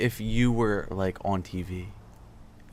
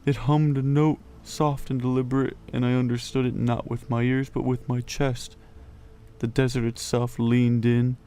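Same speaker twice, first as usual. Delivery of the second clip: story reading; 3 semitones higher, 3 dB louder than usual. Acted. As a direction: read the passage aloud, unhurried. Reads the book passage aloud.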